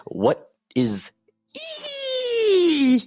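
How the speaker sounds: slow then a squeaky high E; mock confusion. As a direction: ham up puzzled disbelief. Mocking the web address.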